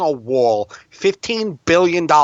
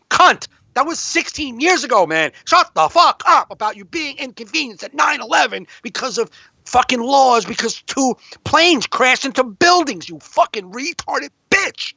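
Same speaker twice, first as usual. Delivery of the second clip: furious rant; 8 semitones higher than usual. Real, not acted. Comedian ranting at a politician, furious high-pitched yelling. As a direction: scream it out in real outrage. Ranting at Kellyanne Conway quote.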